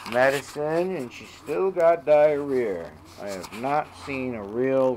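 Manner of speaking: ordinary talk, nothing marked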